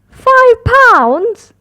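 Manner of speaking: high, loud indignant "Five pounds?"; snorting in disbelief. A narrator's reading. Daddy Pig shocked by the price, high snorting disbelief. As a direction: scoff at the price. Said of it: "snorts Daddy Pig".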